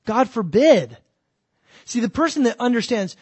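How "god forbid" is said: loud, stern rebuke; louder, indignant correction. Retold or imitated. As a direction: scold the fool with sudden force. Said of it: "And Paul says".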